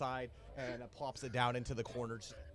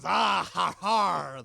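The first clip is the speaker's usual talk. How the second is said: slow, loud drawn-out pirate shout; first mate's cheer; 7 semitones higher, 16 dB louder, much slower than usual. Acted. First mate answers the toast, loud and slow. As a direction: bellow it proudly, stretched out. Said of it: "He goes".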